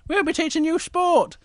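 very high, squeaky bear voice; Little Bear teaching. Acted. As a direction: squeak a greeting to the nursery class.

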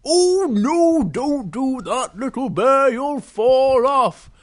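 loud, slow, swooping cry of alarm; alarmed bear warning. Acted. Big Bear warns Little Bear mid-flight, alarmed and loud.

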